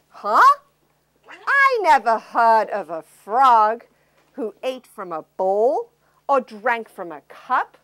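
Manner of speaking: lightly voiced disdainful princess. Princess voice in story.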